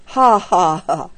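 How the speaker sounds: narrated mock laugh, spelt out; acted hearty ha-ha-ha; big laugh. A narrator's reading. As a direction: laugh it out in spaced ha-has. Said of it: "he gave a big laugh".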